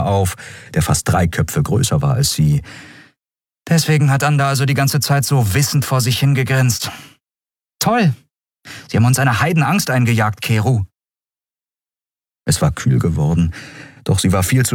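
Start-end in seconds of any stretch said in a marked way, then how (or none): 3.7-10.8 high-pitched, exasperated reproach; annoyed scolding. A narrator's reading. Woman scolds rescuer who scared them, annoyed. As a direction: scold with a sarcastic peak, then rattle on.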